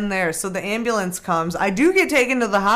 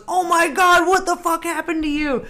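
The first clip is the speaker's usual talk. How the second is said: brother's shocked outburst; 9 semitones higher than usual. Retold or imitated. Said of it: "and he goes".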